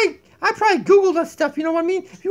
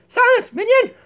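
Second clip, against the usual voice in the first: commanding shout; 5 semitones higher, 5 dB louder than usual. Pretend. Queen commands minion.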